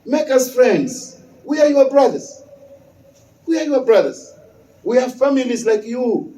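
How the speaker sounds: normal talking voice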